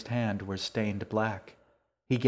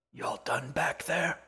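put-on old woman whispering; 6 semitones higher than usual. A narrator's reading. Old woman asks the girl.

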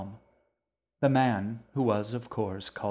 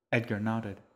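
plain reading voice

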